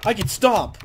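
ordinary talk, nothing marked

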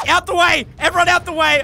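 loud commanding yell; shouting. Acted. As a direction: order everyone back loudly. Clearing room to resuscitate.

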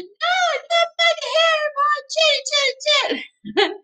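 very high squeaky piggy voice; little pig. Acted. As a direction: squeal the refusal in a tiny voice. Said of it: "And the pig said".

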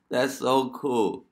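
loud, high, drawn-out delight; slow, excited exclamation of approval. Real, not acted. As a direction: stretch it out, thrilled after a big laugh. Delighted reaction after laughing.

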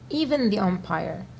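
ordinary delivery, nothing marked

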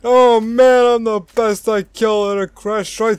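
loud, high victory crowing; hammed-up bragging. Celebrating character brags about the kill, hammed-up boasting. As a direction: boast at full volume, imitating a winner.

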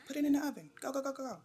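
frantic urging. Real, not acted. Urging sim to use oven.

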